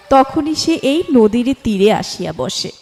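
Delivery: ordinary delivery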